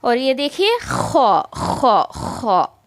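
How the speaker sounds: खो with a rough throat kh; raspy kho. Shown as a demonstration. Teacher demonstrating kho with throaty friction. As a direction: scrape खो in the throat. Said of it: "इसमें ख की आवाज़ आनी चाहिए".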